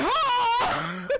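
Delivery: sudden high yelp, half laughing; a startled cry through a laugh; panicked yelp. Retold or imitated. Narrator reliving the needle, panicked yelp. Waking mid-procedure, panicking.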